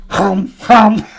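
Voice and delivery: mock grunts. Friend imitates the depressed husband, loud grunting hums. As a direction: grunt like a sulking slob.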